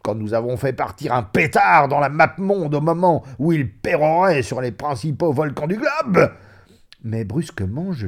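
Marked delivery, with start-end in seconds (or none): 0.0-6.3 high, loud, laughing cries settling into eager storytelling; table-thumping hilarity; uproarious reminiscing. Acted. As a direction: whoop with laughter, then rattle off the memory. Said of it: "il riait, tapait du poing sur la table".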